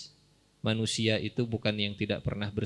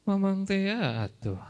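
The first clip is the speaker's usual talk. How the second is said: mock boastful; 8 semitones higher, slower than usual. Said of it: "Kelebihannya gimana?".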